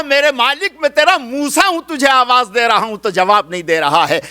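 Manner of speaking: high, loud pleading call; anguished cry to God. Acted. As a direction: cry out to your Lord in distress. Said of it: "تڑپ کے کہا".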